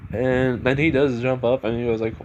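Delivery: ordinary delivery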